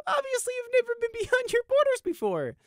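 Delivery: very high, loud gleeful taunt; giggly, high-pitched derision; laughing scorn. A narrator's reading. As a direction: say it still breaking up with laughter. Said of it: "he laughed".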